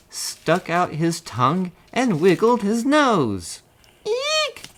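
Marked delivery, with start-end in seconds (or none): 4.1-4.7 very high squeal of fright; frightened shriek. A narrator's reading. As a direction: squeal in sudden terror. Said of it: "shouted the elephant".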